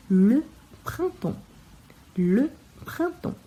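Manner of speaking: printemps said slowly, nasal prin and temps, final ps silent; teacher drilling the hardest word, printemps; slow careful printemps. Shown as a demonstration. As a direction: stretch printemps out syllable by syllable, leaving ps silent. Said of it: "A palavra mais difícil é a primavera".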